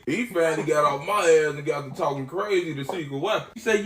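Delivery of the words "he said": normal talking voice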